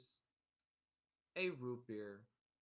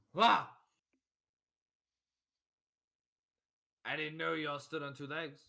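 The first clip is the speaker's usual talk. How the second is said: loud, higher-pitched astonished character; raised, marvelling voice; amazed raggedy man; 6 semitones higher, 15 dB louder than usual. Acted. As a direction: burst out loudly in surprise. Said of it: "And he goes".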